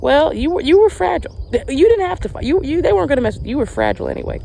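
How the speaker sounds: high, bright mock-scolding mama voice; mother teasing her brother Tony, high playful voice; mama's teasing retort. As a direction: tease like a big sister dismissing the baby. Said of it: "And she's like".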